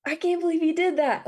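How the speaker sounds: replaying her younger self's outrage at her brother; upset disbelief. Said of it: "I was so upset with him".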